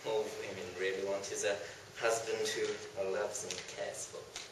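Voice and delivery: low, soft homely woman's voice; low quiet matron; plain wife. Acted. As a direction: answer simply as a housewife. Second woman answers.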